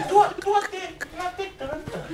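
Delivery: small high voice, consonants swapped for T; imitated speech impediment. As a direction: mimic a tongue-tied boy answering in a high voice.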